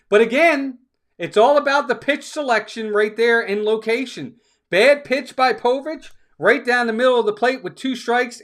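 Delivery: high, loud, deliberate complaint; fired-up criticism. Real, not acted. Broadcaster griping about a bad pitch, high and loud.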